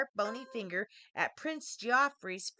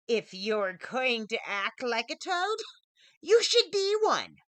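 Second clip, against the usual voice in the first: witch's curse; 6 dB louder than usual. A narrator's reading. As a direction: cast the curse in his face. Hag casts a curse.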